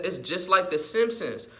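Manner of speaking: usual speaking voice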